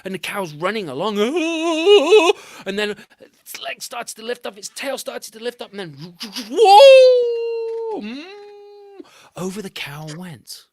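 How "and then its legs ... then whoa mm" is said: rising high and loud into a whoop; building to whoa. A narrator's reading. Narrator telling the cow flying off the tree, building to a loud whoop. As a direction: ride the spin up to a shout.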